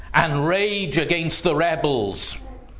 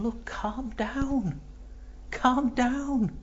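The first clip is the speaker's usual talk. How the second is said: soothing plea; 8 semitones higher, 7 dB quieter, slower than usual. Acted. David soothing his men.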